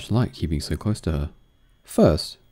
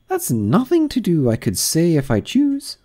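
pleased grande dame; 11 semitones higher than usual. Acted. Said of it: "in a pleased tone".

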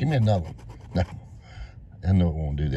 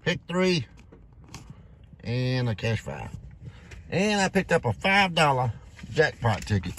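usual speaking voice

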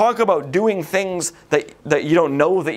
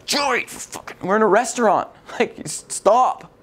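mom hissing a scold; 4 semitones higher than usual. Retold or imitated.

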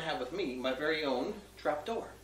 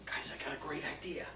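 whispered aside to the crowd; 8 dB quieter than usual. Acted. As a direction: lean in and whisper it. Conspiratorial aside.

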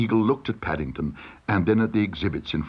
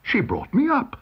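high, affronted protest; indignant exclamation; 13 semitones higher than usual. A narrator's reading. Paddington defends Aunt Lucy, indignant. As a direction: protest in defence of a loved one. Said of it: "exclaimed Paddington".

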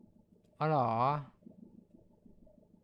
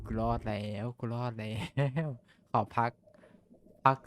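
panting, softer relieved words; out of breath; 5 semitones lower, 7 dB quieter than usual. Real, not acted. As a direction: catch your breath after escaping. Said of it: "หายใจก่อนครับ".

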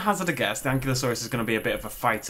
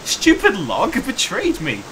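laughing; 10 semitones higher, 7 dB louder than usual. Real, not acted. Stuck on a log.

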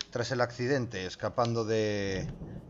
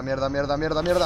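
panicked cursing; 4 semitones higher, 8 dB louder than usual. Real, not acted.

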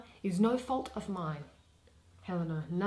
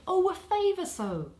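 exclaiming; 10 semitones higher, 5 dB louder than usual. Acted. Helena's outburst of longing.

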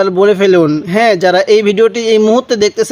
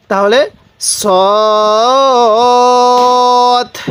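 drawn-out recitation; 2 semitones higher, much slower than usual. Shown as a demonstration. Teacher showing viewers how to recite, very slowly drawn out. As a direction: stretch the letter name out very slowly. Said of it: "হরফটি উচ্চারণ করে ফেলুন".

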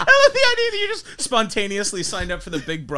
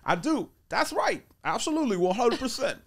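soft, high-lilting comedian voice; playful celebrity impression; Tracy Morgan; 4 semitones higher, 4 dB quieter than usual. Shown as a demonstration. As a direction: agree in a goofy, swooping comic voice. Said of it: "You had a Tracy Morgan".

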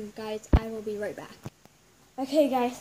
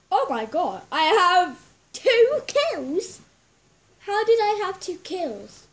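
high, loud amazement; real astonished excitement; surprised; 5 semitones higher, 7 dB louder than usual. Real, not acted. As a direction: gasp out the news in disbelief. Surprised at two kills.

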